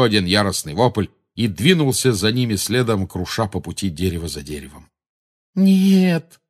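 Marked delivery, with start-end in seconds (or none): 5.5-6.4 high, loud wailed protest; high-pitched, anguished cry; distressed moan. A narrator's reading. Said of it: "простонала Гермиона".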